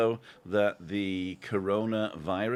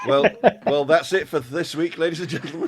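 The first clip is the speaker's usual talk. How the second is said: laughing through the words; giggling sign-off; 6 semitones higher, 7 dB louder than usual. Real, not acted.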